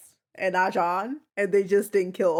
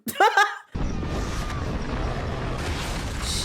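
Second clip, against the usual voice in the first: airy disbelieving laugh; breathy laughter; long laugh. Real, not acted. Laughing at scene.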